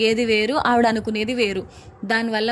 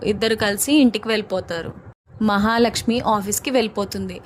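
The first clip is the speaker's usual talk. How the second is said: ordinary delivery, nothing marked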